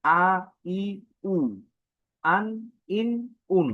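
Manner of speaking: আ ই উ আন ইন উন said short and distinct; crisp recitation of আ ই উ আন ইন উন; hamza vowel drill. Shown as a demonstration. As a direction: model each sound for the class. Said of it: "আবারও বলি".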